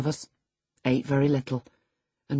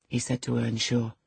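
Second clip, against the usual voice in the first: plain reading voice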